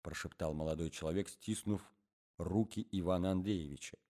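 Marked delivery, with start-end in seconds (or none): none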